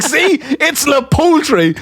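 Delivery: high-pitched, drawn-out gloating; imitated triumph. Coworker showing teller the translation, gloating. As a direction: crow it slowly like you have won.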